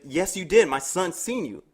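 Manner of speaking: pin the blame on her; mock angry accusation. Girlfriend accusing neighbor.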